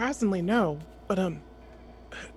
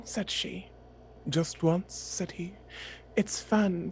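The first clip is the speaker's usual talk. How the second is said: hushed, drawn-out poem reading with he and she voices; poem read in character; 3 semitones lower, 6 dB quieter, slower than usual. Pretend. Character reads E E Cummings on a poetry break, hushed and slow. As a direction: read the poem slowly, voicing both lovers. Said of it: "Lottie can be a voice actor".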